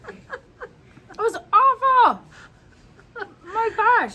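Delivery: slow, high, pained cry from the heat. Real, not acted. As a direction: drag it out high, mouth burning. Reacting to spicy burn.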